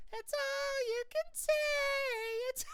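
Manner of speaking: put-on very high sing-song little-girl taunt; Dee Dee taunt. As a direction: tease like a prancing little sister. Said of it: "Dee Dee's prancing around".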